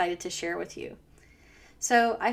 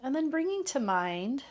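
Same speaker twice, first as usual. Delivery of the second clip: calm, unhurried meditation voice with long pauses; guided meditation. Real, not acted. Teacher settling listeners into a loving kindness practice, guided meditation. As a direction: ease listeners gently into stillness, leaving space between phrases.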